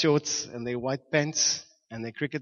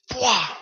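imitated crack; 8 semitones higher, 7 dB louder than usual. Imitating the plank breaking.

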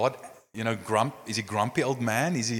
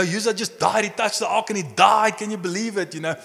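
usual speaking voice

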